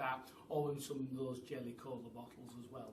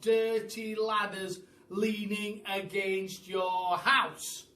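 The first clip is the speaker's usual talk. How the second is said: slow, loud announcer-style reading; reading out a sign; 5 semitones higher, 10 dB louder, slower than usual. A narrator's reading. Boy reading the shop window sign aloud, slow loud advert voice. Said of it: "It said".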